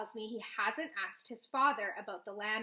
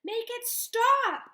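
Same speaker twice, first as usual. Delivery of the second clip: much higher and louder, slow and stretched; tearful scream; 12 semitones higher, 10 dB louder, much slower than usual. A narrator's reading. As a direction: scream through tears. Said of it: "I scream".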